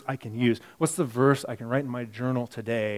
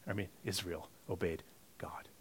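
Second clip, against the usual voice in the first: breathy whispered self-correction; whispered aside; 5 semitones lower, 15 dB quieter than usual. Real, not acted. Preacher correcting himself to the congregation in a whisper. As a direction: drop to a whisper to fix the slip.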